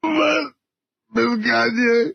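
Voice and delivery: ordinary talk, nothing marked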